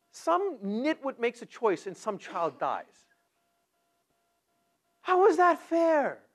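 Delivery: slow, loud, high indignant cry; high, slow outrage; outraged protest. Retold or imitated. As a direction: rail against the unfairness. Preacher voicing his own outrage.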